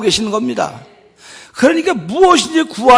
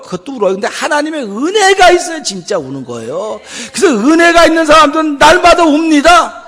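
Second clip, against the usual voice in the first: loud, higher impassioned sermon; fervent preaching; 6 semitones higher, 6 dB louder than usual. Acted. Pastor urging the congregation about tears of grace, fervent. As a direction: preach it with full conviction.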